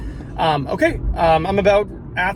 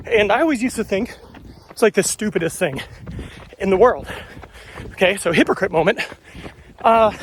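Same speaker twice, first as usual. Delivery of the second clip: talking mid-run, a bit winded; while running. Real, not acted. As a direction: speak while jogging along. I'm holding this phone, talking to you.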